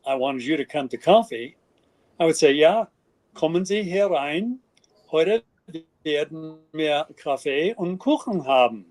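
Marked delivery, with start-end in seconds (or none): 2.5-8.9 crisp standard High German invitation. Shown as a demonstration. Inviting a guest to coffee in High German. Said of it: "That's High German".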